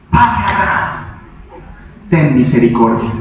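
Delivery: subdued plea, dropping low on the second half; acted plea. Rich man begging Abraham for mercy, subdued acted plea. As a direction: beg with pauses, sinking in pitch. Preacher voices the tormented man's plea.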